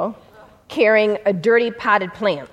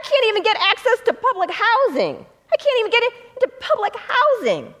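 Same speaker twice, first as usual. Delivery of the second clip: much higher, pleading retold voice; desperate plea; 10 semitones higher than usual. The felon telling his lawyer about housing, high and pleading. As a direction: lift the voice high, begging for help.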